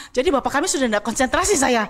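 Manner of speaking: usual speaking voice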